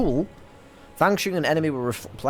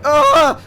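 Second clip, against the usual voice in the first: short, high, loud scream of panic; scared yelp; 12 semitones higher, 9 dB louder than usual. Retold or imitated. Fleeing the threatening chavs, sudden frightened yelp. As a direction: yelp like you've just been threatened with a knife. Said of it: "And you're like".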